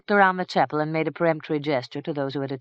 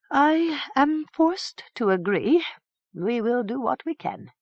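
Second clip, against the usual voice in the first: higher, slow reluctant yielding priest; lightly voiced sighing priest; 10 semitones higher than usual. A narrator's reading. Priest yields to the king, higher and slow. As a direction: give in with a sigh.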